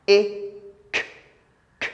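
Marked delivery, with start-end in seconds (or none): none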